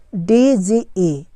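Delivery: very slow, separated letters; one letter at a time; spelling it out. Shown as a demonstration. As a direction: spell it slowly for learners. Spelling a word aloud for learners.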